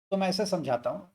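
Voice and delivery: ordinary talk, nothing marked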